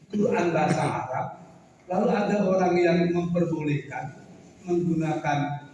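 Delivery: ordinary delivery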